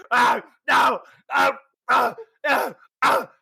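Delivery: high, repeated yelps of protest; panicked Reinhold. Acted. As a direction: yelp as if being slapped repeatedly. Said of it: "Rhino just".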